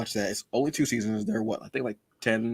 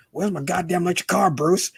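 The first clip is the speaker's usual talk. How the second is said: higher whiny Joker demand; Joker impression; 8 semitones higher than usual. A fan quoting Joker demanding his car from Bruce, higher whine. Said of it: "who does Joker".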